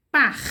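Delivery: "Par" said as its own syllable; sounding out. Shown as a demonstration. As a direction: isolate "Par" for the class. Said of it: "É o P, o A e o R".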